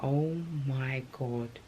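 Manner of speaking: slow, soft, drawn-out dismay; dismayed oh my god. Retold or imitated. Losing her hair after the baby, slow stunned dismay. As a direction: let it sink in slowly, quietly shocked. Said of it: "So I'm like".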